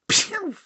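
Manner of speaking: high "pew" sound effect; zapping noise. Acted. Comic dismisses the woman with a high zap. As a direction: fire off a mouth-made zap.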